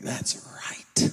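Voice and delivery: breathy retold whisper. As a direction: whisper it as an aside. Quoting himself, hushed.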